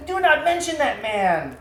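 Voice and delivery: loud, slow, furious command; furious queen forbidding mention of her husband; angry shout. Acted. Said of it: "Nor shouted".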